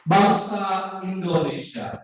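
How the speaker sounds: "Bangsa Indonesia" slow, dropping at the end; falling full-stop tune. Shown as a demonstration. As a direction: read "Bangsa Indonesia" slowly, closing it downward. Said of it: "berarti nadanya turun".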